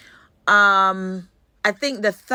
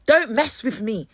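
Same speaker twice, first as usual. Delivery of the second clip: usual speaking voice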